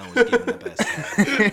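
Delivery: high, loud laughing read. Retold or imitated. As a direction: giggle through the caption. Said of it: "It was like".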